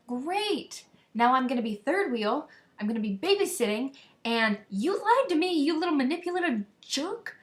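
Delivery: high, loud, seething sarcasm; sarcastic anger. Retold or imitated. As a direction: let the resentment burst out. Furious at lying friend.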